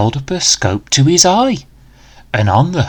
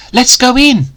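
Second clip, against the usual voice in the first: high, loud call to go inside; rally your partner into the cave; eager cry; 11 semitones higher, 3 dB louder than usual. Acted. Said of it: "Danny cried".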